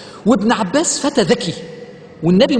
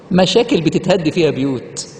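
usual speaking voice

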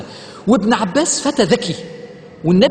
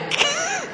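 short laugh; 8 dB quieter than usual. Real, not acted. Laughing at story.

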